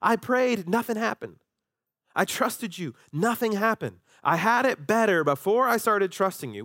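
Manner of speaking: mock-bitter sarcasm to God; sarcastic complaint. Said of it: "we say similar things today".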